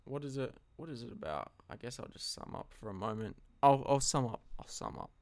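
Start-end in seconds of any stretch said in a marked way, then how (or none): 4.6-5.1 soft breathy whisper to self; whispered aside. Real, not acted. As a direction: drop to a whisper. Repeats line then whispers it.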